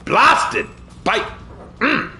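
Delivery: a burst of mock British cursing; too British. Player cursing a misstep in a hammed-up British accent. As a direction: spit out a stuffy British oath. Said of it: "I was a little too British there".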